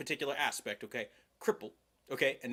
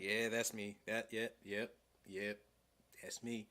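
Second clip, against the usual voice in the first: much softer, fading sheepish admission; deadpan TikTok quote; 6 semitones lower, 9 dB quieter, slower than usual. Retold or imitated. Vet quoting meme, softly owning it. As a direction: mumble it like the meme audio. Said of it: "That's me with the military".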